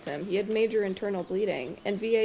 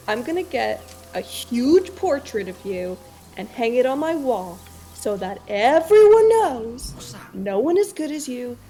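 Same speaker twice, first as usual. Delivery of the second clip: mock solemn vow; 3 semitones higher, 7 dB louder than usual. Mock grand declaration to Kaylee.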